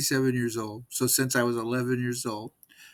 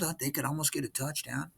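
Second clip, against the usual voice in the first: normal talking voice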